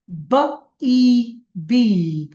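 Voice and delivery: বা ই বি drawn out very slowly; slow phonics reading. Shown as a demonstration. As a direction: stretch বা ই বি, each sound held long. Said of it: "খালি মুখে উচ্চারণ করেন আর বলেন". (বা ই বি খুব ধীরে টেনে টেনে)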